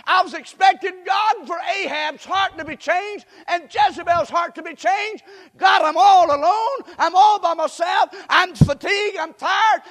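high, loud despairing cry to God; Elijah crying out. Retold or imitated. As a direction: shout the prophet's lament up to heaven. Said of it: "Elijah's saying".

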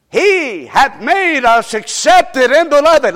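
slow, ringing recitation; solemn verse recital. Acted. Preacher reciting Ephesians to the church, slow and ringing. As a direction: savor each word.

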